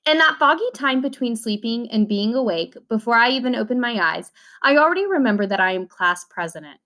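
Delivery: ordinary delivery, nothing marked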